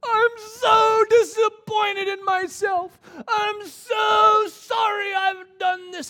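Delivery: slow, high, loud sobbing wail; remorseful crying scream. Retold or imitated. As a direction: cry it out in anguish. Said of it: "They've changed from".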